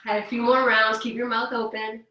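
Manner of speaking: calm, unhurried instructor voice leading breaths; guided breathing. Acted. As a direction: guide the class gently through the yawn.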